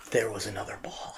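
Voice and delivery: usual speaking voice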